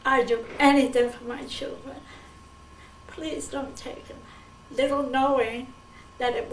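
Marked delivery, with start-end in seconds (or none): 0.0-4.2 mild pleading, higher at the end; understated mother's plea; retold begging. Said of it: "I said".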